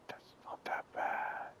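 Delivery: whispered that's not that bad. Real, not acted. Player judging his edit, whispered first. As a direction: breathe it without voice.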